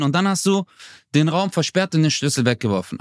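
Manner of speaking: normal talking voice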